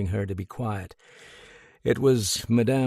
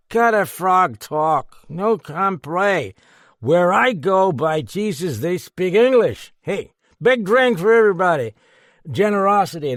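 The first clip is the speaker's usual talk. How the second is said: put-on loud drunken rant; 9 semitones higher, 7 dB louder than usual. A narrator's reading. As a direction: bellow like a happy drunk. Drunk rants at policeman.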